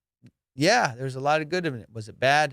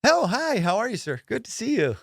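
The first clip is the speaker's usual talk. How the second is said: polite tone; 9 semitones higher than usual. Retold or imitated. Buyer greeting the seller in an overly proper, high voice. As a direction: put on your best church-boy politeness. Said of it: "I change my tone".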